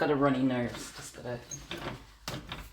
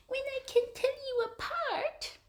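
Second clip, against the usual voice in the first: very high pet-talk coo; 4 dB quieter than usual. Real, not acted. Cat sitter telling a kitten about names, high baby talk. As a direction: say it sky-high, to a tiny animal.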